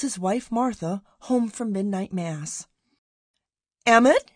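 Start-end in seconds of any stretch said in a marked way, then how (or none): 3.9-4.3 loud, high rising call of his name; excited call. A narrator's reading. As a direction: call out to him, bursting with news. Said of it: "she cried, her voice high-pitched with excitement".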